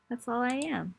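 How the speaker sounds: ordinary talk, nothing marked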